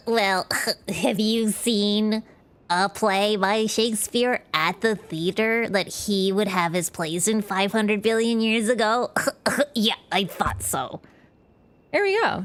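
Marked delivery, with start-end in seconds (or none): 0.1-11.0 slow, pedantic nerd voice. Pretend. A slow, smug nerd quizzing chat. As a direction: quiz them like a smug nerd. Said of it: "Now let's do the nerd voice".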